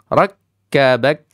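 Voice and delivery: Rakkabak loud, with a hard closed k at the end; strong stopped k. Shown as a demonstration. Teacher stressing the syiddah ending to kids. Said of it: "sifatnya syiddah dia kuat".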